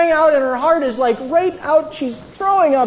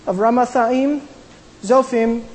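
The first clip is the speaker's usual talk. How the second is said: ordinary talk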